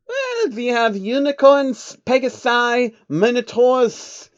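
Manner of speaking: put-on very high, slow childlike creature voice; proudly listing home creatures; eager changeling.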